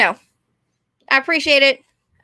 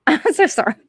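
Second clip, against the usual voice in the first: real laugh through the words; higher, giggly apology; 4 semitones higher than usual. Real, not acted. Apologizes to viewers while laughing.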